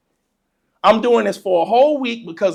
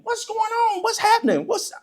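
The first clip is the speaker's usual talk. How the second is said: put-on high-pitched, softer kid's questions; insistent questioning; 13 semitones higher, 4 dB quieter than usual. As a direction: pester like a confused child. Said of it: "I'm still asking".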